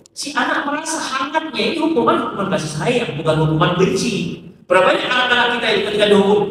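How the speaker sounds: ordinary talk, nothing marked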